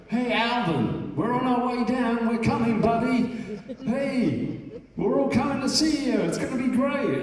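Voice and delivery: high, loud, sing-song mock American; Jerry's phone message. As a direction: imitate an excited friend yelling into an answering machine. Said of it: "Jerry going".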